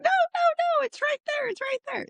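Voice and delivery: put-on high childlike voice, pleading and insistent; scared little girl. Mom imitating her scared daughter in a high little-girl voice. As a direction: cry out like a frightened small child pointing at the closet.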